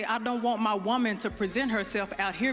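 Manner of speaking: ordinary talk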